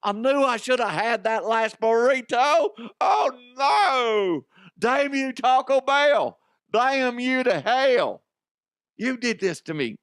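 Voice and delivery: high, hammed-up anguished wail; man stuck in chimney. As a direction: curse your fate melodramatically, loud and high. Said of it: "little whispers he's saying to himself".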